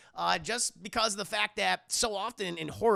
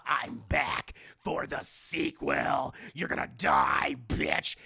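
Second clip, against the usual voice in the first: low, slow mock villain threat; hammed-up horror-movie killer; menacing killer; 4 semitones lower, much slower than usual. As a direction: drag it out deep, leaning on the threat.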